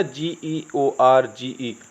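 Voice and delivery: ordinary talk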